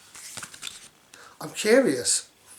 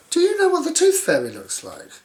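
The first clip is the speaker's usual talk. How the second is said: much higher, louder eager question; high curious voice; 13 semitones higher, 5 dB louder than usual. Acted. Little Miss Curious asking Mister Muddle, high and eager.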